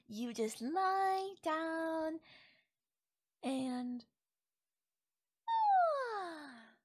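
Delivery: slow, drawn-out lie down ending on a high sigh; showing how to relax. Shown as a demonstration. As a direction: stretch it out to show how easy it is.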